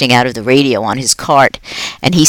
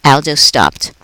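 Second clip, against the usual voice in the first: plain reading voice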